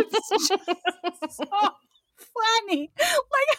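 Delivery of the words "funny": ordinary talk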